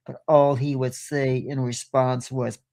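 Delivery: usual speaking voice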